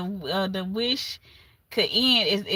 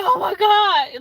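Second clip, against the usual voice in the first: mock surprised gasp; 8 semitones higher, 5 dB louder, slower than usual. Mocking his fake surprise.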